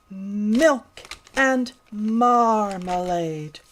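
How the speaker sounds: slow, drawn-out mm sounds; milk and marmalade stretched with long mm; slow, careful. Shown as a demonstration. Little pig slowly practising milk and marmalade. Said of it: "Can you make the mm sound".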